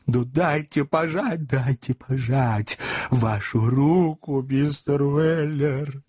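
fawning, slow. A narrator's reading. Job fawns over Sam.

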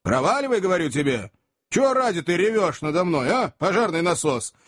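high, exasperated scolding; indignant. A narrator's reading. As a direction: push him off, fed up. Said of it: "возмущённый таким поведением". (Сэм возмущённо отталкивает плаксу, высоко и раздражённо)

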